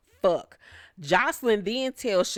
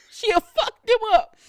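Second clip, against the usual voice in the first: high-pitched, loud imitation of a threat; mock threat; 14 semitones higher, 5 dB louder than usual. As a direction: mimic her threat way up high. Repeats the threat as an impression.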